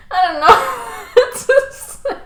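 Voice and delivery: high-pitched words swallowed by giggles; laughing so hard the words barely come out; helpless laughter. Real, not acted. Laughing at the grandma confession.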